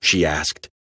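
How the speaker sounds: usual speaking voice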